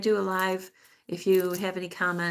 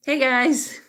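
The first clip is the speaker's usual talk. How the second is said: higher, louder hey to the viewers; own signature greeting; 8 semitones higher, 6 dB louder than usual. Shown as a demonstration. Vlogger showing her own greeting, called out high and loud. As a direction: call it out brightly to your followers. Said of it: "That's me".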